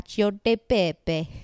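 slow put-on Italian accent; imitating a viral TikTok girl; mock Italian accent. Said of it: "girl on TikTok who said it like that".

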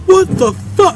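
high-pitched mock outrage; imitating yelling doctor. Doctor scolding his patient, high mock yelling. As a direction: squawk it as an appalled doctor. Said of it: "the doctor started yelling at me".

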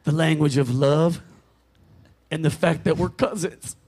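put-on a smug country husband in a Southern accent, pitched low. Husband answers his wife in a low Southern accent. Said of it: "I'm gonna do an accent".

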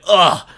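put-on loud, high teen groan of disgust; exasperated teenage ugh; annoyed groan. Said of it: "And Terry's like".

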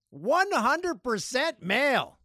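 high, slow, drawn-out proclamation; mock triumph. Host repeats the listener stat, high mock proclamation. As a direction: proclaim it big, stretching every word.

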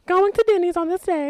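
excited announcing. Acted. Announcing the plan.

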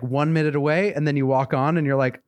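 ordinary delivery

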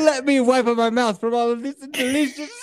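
high cartoon Italian boy; mock Italian accent. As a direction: spoof a polite Italian kid. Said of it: "Sounds Italian".

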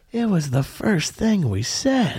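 slow, drawn-out scolding; slow mock reprimand; shaming. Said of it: "shaming grown-ups is really fun".

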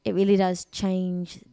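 calm, put-on British accent; measured British affectation; affected British accent. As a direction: play at sophisticated British, unhurried. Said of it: "the way I'm talking now".

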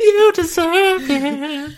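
very high, slow, loud squeal; mock cheerleader. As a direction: squeal it like a giddy fan. Joins mock cheer, laughing.

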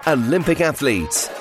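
ordinary talk, nothing marked

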